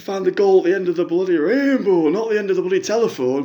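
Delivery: a lightly put-on middle-aged fan on the phone; a fan phoning in with a sob story, lightly voiced; Jackie caller voice. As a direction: play the hard-up caller, chatty and woeful.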